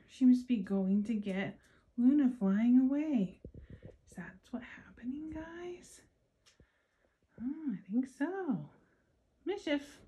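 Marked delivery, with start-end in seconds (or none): 4.1-10.0 quick whispered question; whispering. Real, not acted. Aside to kids.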